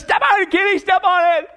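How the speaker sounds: high-pitched mock panic; terrified urging. Al begs Kenny to drive off, high and panicked. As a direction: urge the driver on in acted terror. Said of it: "rolls up the window in terror".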